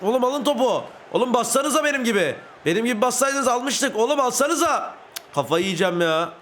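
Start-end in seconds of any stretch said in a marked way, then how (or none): 0.0-4.9 higher, urgent scolding of teammates; exasperated calling out. Real, not acted. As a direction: yell at your teammates to get the ball. Yelling at teammates to press. (takım arkadaşlarına sinirle bağırarak)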